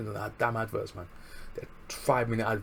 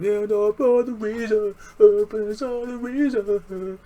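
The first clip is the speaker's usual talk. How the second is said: higher, louder, slow drawn-out catchphrase; Red Dragon catchphrase; 12 semitones higher, 9 dB louder, much slower than usual. Retold or imitated. Fan imitating a wrestler's catchphrase, higher and louder. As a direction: stretch it out like a ring announcer chant. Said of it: "what I just did there".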